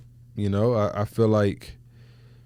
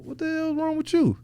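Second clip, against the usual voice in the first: retold irritated outburst, pitched higher; angry snap-back. Husband snapping when somebody says something to him, mildly angry. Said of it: "comes out in a form that's angry, that's mad".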